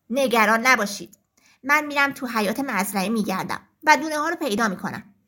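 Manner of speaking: rooster reassuring the hens; confident rooster. Acted.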